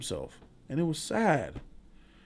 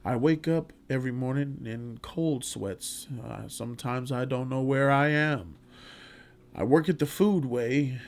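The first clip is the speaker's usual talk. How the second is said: put-on old man's letter; 3 semitones lower, 6 dB quieter than usual. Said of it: "while he's reading this letter".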